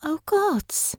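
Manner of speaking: high, hushed realization; whispered. A narrator's reading. Honor grasps who Billy was, whispering. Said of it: "she whispered".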